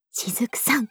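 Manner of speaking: whispered name. Real, not acted. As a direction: breathe the name reverently.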